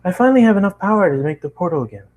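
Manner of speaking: high, quick eager pony voice; Twilight voice. A narrator's reading. Twilight telling friends the portal is ready, high and quick. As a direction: rush the news out. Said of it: "Twilight remembered".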